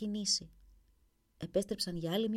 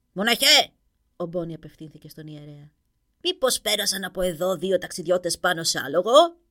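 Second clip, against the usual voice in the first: loud call; 2 semitones lower, 11 dB louder than usual. Acted. King Boni calls the priest.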